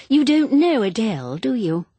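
high, loud social gush; exclaiming cry. A narrator's reading. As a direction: exclaim it as a sudden realisation. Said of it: "cried Jennifer".

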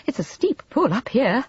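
puffed, apologetic remark. A narrator's reading. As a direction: excuse yourself while catching breath. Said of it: "she said apologetically".

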